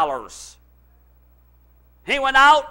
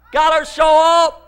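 higher, louder repeated cry; preacher's shout; 8 semitones higher, 9 dB louder than usual. Real, not acted. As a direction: shout it out to the congregation.

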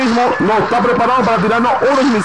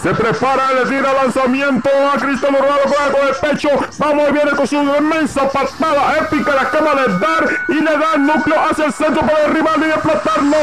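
high excited sports commentary; live play-by-play; 6 semitones higher than usual. Acted. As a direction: call the play like a goal. Epic play-by-play of Cristiano.

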